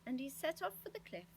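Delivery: usual speaking voice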